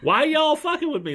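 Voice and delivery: put-on Southern Belle accent. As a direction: play a fired-up Southern Belle. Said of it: "Like full on Southern Belle".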